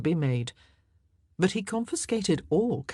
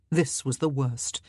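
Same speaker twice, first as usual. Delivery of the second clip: ordinary delivery, nothing marked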